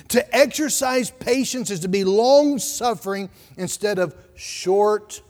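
ordinary delivery

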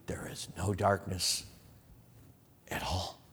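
whispered low and slow; hushed whisper. Acted. Preacher whispering the verse to his listeners, hushed. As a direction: drop to a secret whisper.

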